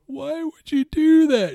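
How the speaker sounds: high, loud, slow incredulous cry; mock outrage. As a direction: throw up your hands at the rogue, high and loud. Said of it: "And I'm, like, going".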